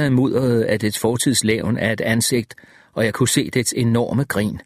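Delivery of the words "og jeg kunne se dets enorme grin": ordinary delivery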